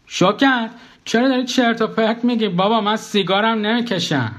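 high-pitched, affronted denial; indignant protest. Acted. Narrator denies smoking to his ex-wife, indignant protest.

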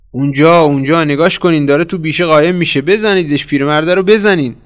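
high, loud, urgent alarm; shouted warning. A narrator's reading. Pursuer alerting the gang as he slips into the bushes, shouted warning. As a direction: call out the sighting and urge the attack. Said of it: "هشدار داده شد".

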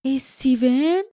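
mildly sinking-hearted, rising question; faint, dismayed question. A narrator's reading. Lief asking Doom with a sinking heart, weakly. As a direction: ask it as if your heart just sank. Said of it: "با صدای ضعیفی پرسید".

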